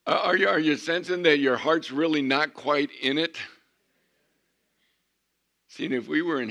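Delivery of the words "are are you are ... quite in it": rising, louder challenge to the crowd; loud preaching push. Real, not acted. Preacher challenging a half-hearted congregation, loudly. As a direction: press them hard, voice raised. Preacher chiding the flat response.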